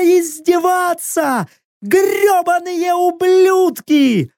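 high, drawn-out frenzied cursing; screamed curse. A narrator's reading. Captive cursing her jailers, high anguished screaming. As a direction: scream curses from behind bars, slow and high. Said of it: "крик проклятия повторился".

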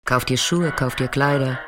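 normal talking voice

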